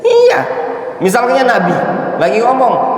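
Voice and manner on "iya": sharp shout. Real, not acted. Sudden high shout.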